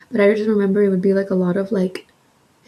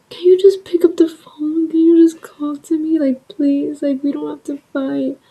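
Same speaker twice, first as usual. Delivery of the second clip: high-pitched mock-crying begging; imitated tearful plea; 7 semitones higher, 3 dB louder than usual. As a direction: plead as if crying, voice pushed up high. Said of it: "I was, like, crying".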